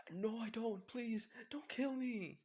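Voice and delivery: high, loud, slow begging; terrified pleading. Acted. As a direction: drag out the plea in panic. Guy begs not to be killed.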